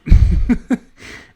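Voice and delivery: loud burst of laughter; real laugh. Real, not acted. Player amused by the solid mountain range, laughing. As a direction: crack up helplessly at the absurdity.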